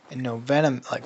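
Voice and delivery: usual speaking voice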